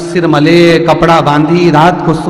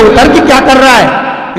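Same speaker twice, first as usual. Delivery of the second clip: 1 shouted demand; 7 semitones higher, 5 dB louder, slower than usual. Acted. Aunt yells at boy.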